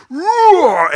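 loud, high mock lion roar. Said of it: "he gr-, roared".

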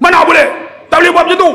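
tengking orang dengan lantang dan tinggi; marah. Retold or imitated. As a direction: lakonan pegawai kaunter yang garang. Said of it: "Dia cakap macam tu". (tengking dengan suara marah)

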